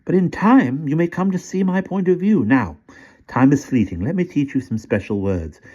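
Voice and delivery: put-on sly old British gentleman. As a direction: coax the boy like a sly old tempter. Old man resumes the lesson.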